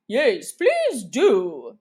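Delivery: slow, drawn-out group voice; chorus. Acted. Animals joining Alice's plea in chorus, slow. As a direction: plead together as a crowd. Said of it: "the others all joined in chorus".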